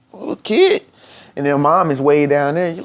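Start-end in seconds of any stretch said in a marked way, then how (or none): 0.1-0.8 put-on much higher, sing-song call to a small child. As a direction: call the little kid sweetly. Calling to a kid who bumped you.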